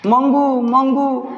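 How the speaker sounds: high calling out of a name; mother crying out her daughter's name; anguished cry. A narrator's reading. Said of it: "ऐसी चीखें माँ जी ने पुनः निकाली".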